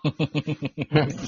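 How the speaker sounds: a soft breathy laugh, almost under the breath. Real, not acted. Podcast host laughing at the school robot story. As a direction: laugh quietly to yourself at the absurd news.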